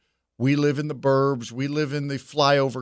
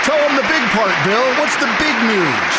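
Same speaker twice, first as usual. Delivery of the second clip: much higher and louder, egging him on; mock hype; 9 semitones higher, 6 dB louder than usual. Host urging Clinton on in the clip, mock hype shout. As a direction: hype the speaker like a rally crowd.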